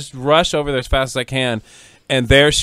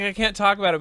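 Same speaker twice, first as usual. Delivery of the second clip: high, coy mock refusal; imitated reluctance; 7 semitones higher, 3 dB quieter, faster than usual. Host mimicking her own refusal to gossip, coy. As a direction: play at holding gossip back. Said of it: "'cause I was like".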